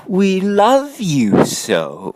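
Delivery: a lovesick monster crooning; lightly voiced, tender creature plea; pleading monsters. A narrator's reading. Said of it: "But the wild things cried".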